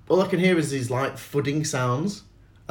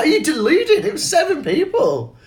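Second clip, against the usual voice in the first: incredulous mock; 4 dB louder than usual. Mocking the heckler's counting.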